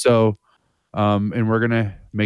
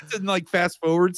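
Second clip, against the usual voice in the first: usual speaking voice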